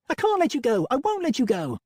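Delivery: high, loud, pleading cry; cling on, begging; desperate plea. A narrator's reading. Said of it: "hugged me fiercely".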